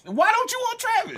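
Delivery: incredulous. Real, not acted. Incredulous question.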